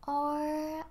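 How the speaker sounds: ordinary talk, nothing marked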